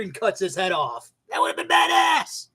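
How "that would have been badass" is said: high, loud thrilled yell; excited shout. Real, not acted. Hyping up his own better ending with a high excited shout. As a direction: shout it like the best idea ever.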